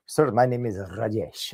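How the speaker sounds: put-on slow, haughty Indian-accented waiter; snooty Indian accent, drawn out. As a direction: correct him with acted disdain. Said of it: "and very, very snooty".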